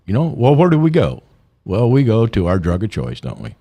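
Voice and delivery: usual speaking voice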